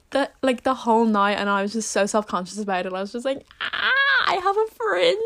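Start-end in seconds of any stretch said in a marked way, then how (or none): 3.6-5.2 very high, whiny dramatic wail; mock despair. Self-conscious at the party, a high whiny wail. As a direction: ham up the hopeless moan.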